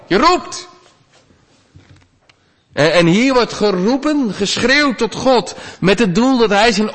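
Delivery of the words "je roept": pitched much higher, a drowning man's shout; a mild, short cry for help; calling for help. Shown as a demonstration. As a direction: yell out from the water. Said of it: "Als je te water bent".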